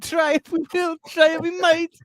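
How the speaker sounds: very high sing-song mock Irish-Jamaican accent; hammed-up Irish-gone-Jamaican lilt, way up high. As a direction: mimic a botched Irish accent that comes out Jamaican, high-pitched. Mimics the accented commercial just played.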